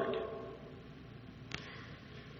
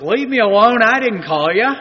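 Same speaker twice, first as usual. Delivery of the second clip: louder, irritated sleepy brush-off; grumpy old Eli; 3 semitones higher, 6 dB louder than usual. Retold or imitated. Eli woken from sleep, snapping at Samuel. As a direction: shoo the boy away, grumbling. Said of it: "you can just imagine how this really went down".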